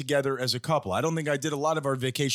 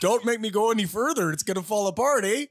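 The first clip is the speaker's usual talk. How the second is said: put-on Canadian accent pitched higher; mock Canadian accent; 7 semitones higher than usual. Straining to keep up a mock Canadian accent. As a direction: keep the Canadian voice going, almost breaking. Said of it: "Not a bad Canadian".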